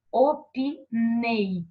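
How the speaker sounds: Opinei drawn out slowly, with the last syllable nei hit hard; stress on final syllable. Shown as a demonstration. Teacher showing students the final stressed syllable, slow Opinei with nei stressed. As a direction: stretch Opinei slowly and land firmly on nei. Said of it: "A sílaba mais forte tá aqui no final".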